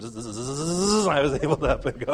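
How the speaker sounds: playful hummingbird buzz; buzzing zzz. Retold or imitated. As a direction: buzz like a hummingbird getting its energy back. Sugar rush buzzing like hummingbird.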